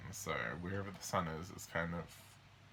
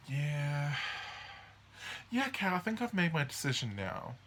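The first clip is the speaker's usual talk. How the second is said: mock hesitant decision; 5 semitones higher than usual. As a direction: hedge before owning the choice. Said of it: "mutable signs are going".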